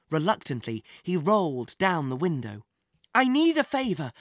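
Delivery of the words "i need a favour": breathless. Acted. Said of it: "said Jack breathlessly".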